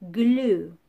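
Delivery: Glue said loudly and over-clearly; slow clear model word. Shown as a demonstration. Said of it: "Repeat after me".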